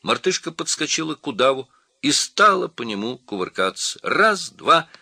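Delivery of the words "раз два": high, loud counting cry; squeaky shouted count; shouting monkey. A narrator's reading. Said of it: "кричала мартышка".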